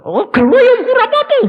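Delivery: quick loud jokey reply; father brushing off the complaint; high loud retort. Retold or imitated. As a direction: toss it back loud and high. Father answers loudly.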